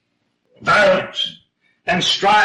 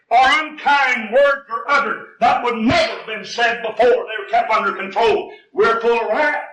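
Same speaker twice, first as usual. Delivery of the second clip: fiery preaching; 6 semitones higher than usual. Acted. Preacher warning the church about wrath, fiery and slow. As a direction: hammer each word of the sermon home.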